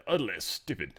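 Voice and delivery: low, soft mock-British voice; British accent. Host imagines the spokesman's quote, in a British accent. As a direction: put on a cool British accent, quietly. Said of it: "he wouldn't say it British".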